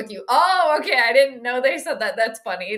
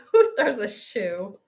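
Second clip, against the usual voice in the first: Who throws a shoe? drawn out slowly and lower; slow, drawn-out quote; 4 semitones lower, slower than usual. Retold or imitated. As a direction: stretch each word of Who throws a shoe?. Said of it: "I have that queued up".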